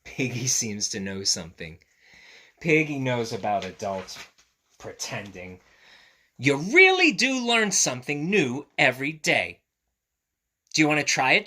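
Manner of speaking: lively picture-book read-aloud; reading to children. A narrator's reading. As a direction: read it brightly to little ones.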